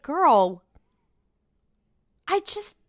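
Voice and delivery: normal talking voice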